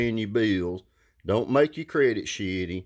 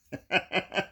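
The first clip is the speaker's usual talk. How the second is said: short laugh; 8 semitones higher, 3 dB quieter than usual. Real, not acted. Laughs at pushy sales caller.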